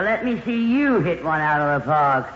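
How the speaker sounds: ordinary talk, nothing marked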